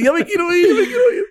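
mock whiny plea. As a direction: whine it high like a scared kid. Mimics friend wanting to leave.

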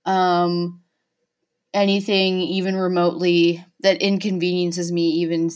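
usual speaking voice

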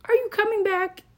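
sing-song it like a breezy note to an ex; high, falsely cheerful sign-off to the ex; fake sweet email. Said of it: "just be like".

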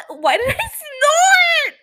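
real laugh breaking through the words; words squeezed out through laughter. Real, not acted. Laughing at her own snort.

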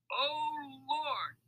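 high, drawn-out cry of hilarity; helpless outcry. A narrator's reading. Mac recovers from a laughing fit, helpless outcry. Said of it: "he cried when he could finally speak".

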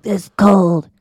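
hammed-up sick man whine; mock dying husband. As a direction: overdramatic sick husband impression. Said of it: "right now you're like".

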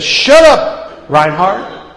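mock self-scolding; scolding himself. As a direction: tell yourself off sharply. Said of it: "He said".

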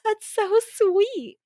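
high, slow gushing coo; drawn-out high-pitched aww. Retold or imitated. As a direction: coo it like you're melting at something cute. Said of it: "And I was like".

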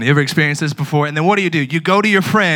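normal talking voice

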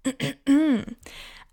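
clears throat loudly; throat clearing. Real, not acted. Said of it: "my voice is so raspy today".

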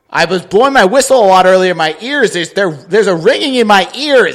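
high, loud mock complaint; mock indignant comeback. Ref's mock comeback to a yelling player, loud and exaggerated. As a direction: play up the exaggerated excuse loudly. Acted sarcastic comeback to a yeller.

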